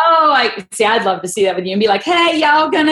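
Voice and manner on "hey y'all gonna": mock her Southern twang lightly; Southern accent, loud and playful. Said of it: "my Southern accent gets way worse".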